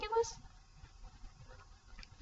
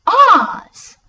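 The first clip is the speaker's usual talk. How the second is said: a high, loud, hammed-up shout; mock enthusiasm; 9 semitones higher, 14 dB louder than usual. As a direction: announce it like a joke answer. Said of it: "mock enthusiasm".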